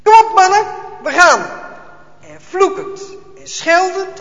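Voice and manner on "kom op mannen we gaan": loud, high command; barked order. A narrator's reading. Officer ordering his soldiers out of the house, loud. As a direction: call the men out.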